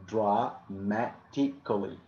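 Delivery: "dramatically" very slowly, dra-ma-ti-cally; syllable by syllable. Shown as a demonstration. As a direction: break "dramatically" into slow separate syllables. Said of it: "we pronounce it like".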